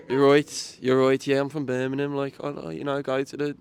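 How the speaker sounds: put-on laughing Brummie accent, easing off; a lightly done Birmingham accent. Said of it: "That's, it's decent".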